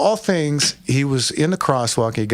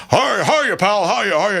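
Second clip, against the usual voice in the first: put-on higher, chirpy old-timer calling hiya; a higher, friendly old man saying hiya, hiya; old Charlie's greeting; 7 semitones higher, slower than usual. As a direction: greet the kid like a cheery old regular at the window. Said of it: "he was in his seventies".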